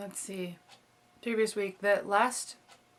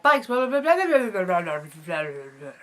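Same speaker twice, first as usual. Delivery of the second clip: excited babbling; 8 dB louder than usual. Retold or imitated. Mimicking man rambling about bikes.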